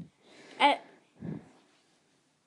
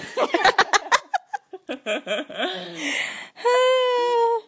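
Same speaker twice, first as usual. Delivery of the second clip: laughing; 11 semitones higher, 5 dB louder than usual. Real, not acted. Laughing at own accent.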